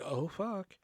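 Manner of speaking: soft retold under-the-breath curse; dreading being called on, barely voiced; muttered dread. Student called on by teacher, mild muttered panic. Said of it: "And I was like".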